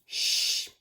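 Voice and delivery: isolated hushed "sh"; sh sound. Shown as a demonstration. Teacher naming the first sound, separate long "sh". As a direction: set "sh" apart as a pure unvoiced sound. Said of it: "because it begins with a".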